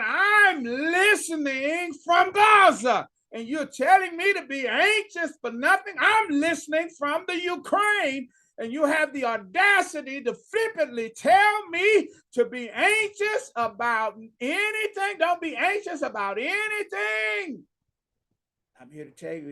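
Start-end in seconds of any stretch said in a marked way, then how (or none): 0.0-3.1 slow, high pleading shout; anguished shout. Acted. Listener cries out to preacher. 3.3-17.6 high, pleading, anguished complaint to the preacher; voicing a desperate listener.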